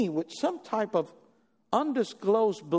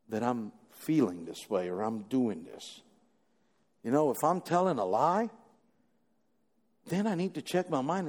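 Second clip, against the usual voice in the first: reflective sermon voice; 8 semitones lower than usual. Acted. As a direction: bring it down to an intimate teaching tone. Quiet reflective preaching.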